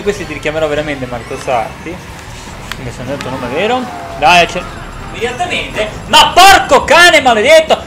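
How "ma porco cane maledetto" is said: higher, louder angry curse; real yell. Real, not acted. Gamer hit on the first curve, angry yell. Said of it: "ora sì che sto urlando".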